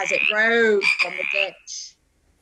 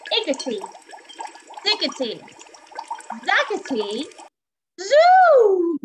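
high, spaced magic words, loud finish; spell incantation; 9 semitones higher, 6 dB louder than usual. A narrator's reading. Witch stirring her cauldron, casting a spell. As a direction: cast the spell, building to a shout. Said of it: "she muttered a spell".

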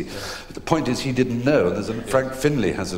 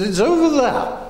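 put-on grand old actress; 7 dB louder than usual. Edith Evans at tea with friend.

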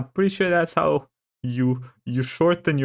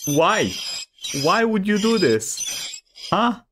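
higher-pitched, drawn-out exasperated questioning; 7 semitones higher, slower than usual. Real, not acted. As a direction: plead for an explanation in a raised voice. Confronting Callie over her clip.

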